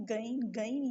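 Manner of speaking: گین twice, like a g sound; wrong thin ghain. Shown as a demonstration. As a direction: say گین as a plain g. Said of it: "غین نہیں". (گین گین، گاف کی طرح باریک)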